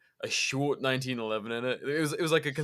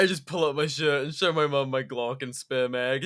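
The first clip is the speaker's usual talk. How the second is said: ordinary delivery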